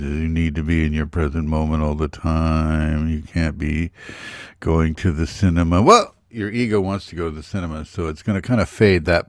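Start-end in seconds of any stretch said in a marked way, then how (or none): 0.0-5.8 a hint of a droning old guru; monotone voice. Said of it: "going on with a monotonous voice".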